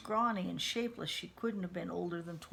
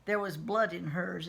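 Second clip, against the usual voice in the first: plain reading voice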